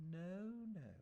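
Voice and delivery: high, gentle pleading refusal; earnest and kind. A narrator's reading. Marian gently urging the narrator to stay, earnest and kind. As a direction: kindly hold him back. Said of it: "she said, earnestly and kindly".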